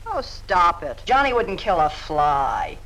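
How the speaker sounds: ordinary talk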